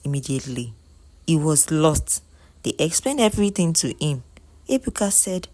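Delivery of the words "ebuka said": ordinary delivery, nothing marked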